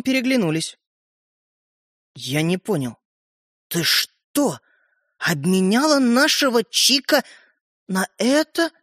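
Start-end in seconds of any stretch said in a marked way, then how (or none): 2.1-8.7 halting, stunned disbelief; crestfallen. A narrator's reading. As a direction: let the words come out in pieces, dismayed. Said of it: "упавшим голосом сказал Родька". (упавшим голосом)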